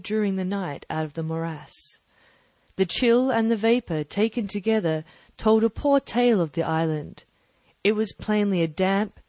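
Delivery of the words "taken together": usual speaking voice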